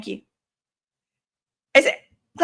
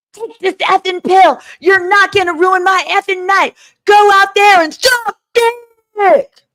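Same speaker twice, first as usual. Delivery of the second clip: pitched way up and louder, a hammed-up rant; mock furious order; 11 semitones higher, 5 dB louder than usual. Retelling Jane's testimony, a high loud mock tirade. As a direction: bark the order like a bully, high and loud, slowing on the last line. Acting out quoted pill demand.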